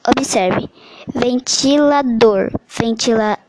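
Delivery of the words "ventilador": "ventilador" slowly, leaning on final DOR; "ventilador" drawn out, DOR as the stressed syllable. Shown as a demonstration. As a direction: sound out "ventilador" with the last syllable DOR strongest. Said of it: "a sílaba dor é aquela que pronunciada com maior intensidade".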